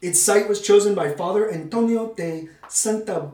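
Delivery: ordinary talk, nothing marked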